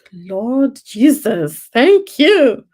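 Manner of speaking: loud, drawn-out cry of gratitude rising high; exultant thanks. Acted. Looking back on six years abroad, exultant thanks to God.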